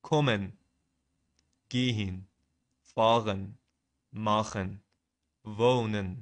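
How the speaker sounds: careful, separated German words; measured word-by-word German pronunciation; pronunciation demo. Shown as a demonstration. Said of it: "ببینید اول تلفظاشو".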